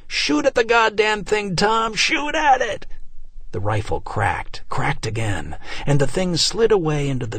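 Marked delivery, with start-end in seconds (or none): 0.1-2.8 high, desperate yelling order; urgent shout. A narrator's reading. Man urging neighbor Tom to fire, desperate shout. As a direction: yell at him to shoot.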